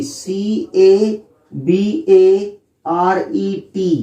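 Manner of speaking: letters spaced out very slowly; slow spelling out. Shown as a demonstration. Teacher dictating the spelling of cabaret, very slow. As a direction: dictate each letter deliberately. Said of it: "उसकी spelling क्या होगी?".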